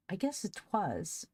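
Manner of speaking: a touch of laughter in it; laughing. A narrator's reading. Polly agrees with her cheered-up brother, lightly laughing. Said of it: "laughed Polly back again".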